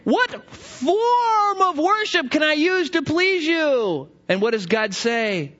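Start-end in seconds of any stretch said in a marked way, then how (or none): 0.1-4.1 pleading plea. Acted. Worshipper pleading with God.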